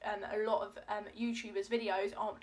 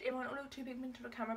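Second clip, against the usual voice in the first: ordinary talk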